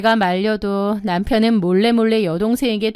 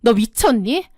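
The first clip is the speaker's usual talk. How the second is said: higher, heated scolding that builds; angry confrontation; 5 semitones higher than usual. Acted. Older sister phoning to confront her sibling, angrily scolding. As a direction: tear into her, voice rising as you go. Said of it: "동생에게 전화를 걸어 따졌습니다".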